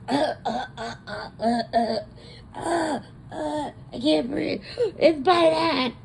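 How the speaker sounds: high, gasping, half-crying splutter; the workers sprayed, gasping and crying out; choking panic. Retold or imitated. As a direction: choke on the gas and panic. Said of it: "And they're like".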